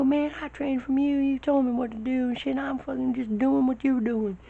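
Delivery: very high yelled warning; high strained shout mid-air; shouting over wind. Retold or imitated. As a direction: yell at him that he's messed up. Yelling back over wind.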